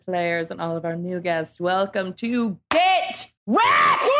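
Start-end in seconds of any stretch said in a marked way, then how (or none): 1.6-4.1 slow shouted show intro; shouting. Acted. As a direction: belt out the show name slowly.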